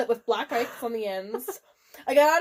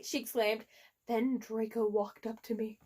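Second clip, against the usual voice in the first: normal talking voice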